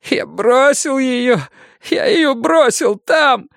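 high, slow, sobbing confession. Acted. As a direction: weep it out slowly. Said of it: "зарыдал юноша".

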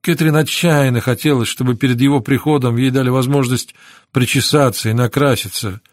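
ordinary delivery

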